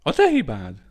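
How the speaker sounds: shouted obsessive accusation; repeating the blame. A narrator's reading. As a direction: keep hammering the blame.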